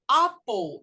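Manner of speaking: British pronunciation of "apple", shorter back first vowel; British English. Shown as a demonstration. Teacher shows the alternative is also right, British English. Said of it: "é o britânico".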